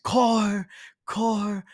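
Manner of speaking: put-on high, wailing drunk plea; mock hysterical crying. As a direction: imitate bawling for an ex. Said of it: "I'm hysterically crying".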